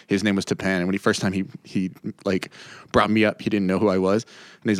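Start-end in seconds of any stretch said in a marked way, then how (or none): none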